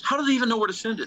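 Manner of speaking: high, fast, worried wife voice; fretting wife. Retold or imitated. Wife quizzing her husband about the mailed photo, high and fast.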